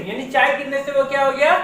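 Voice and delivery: ordinary talk, nothing marked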